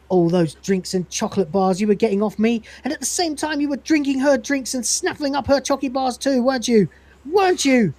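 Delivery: wronged-lover indignation, played up; jealous accusing. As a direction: pile up the accusations, rising to the challenge. Jealous vending machine confronts Dave.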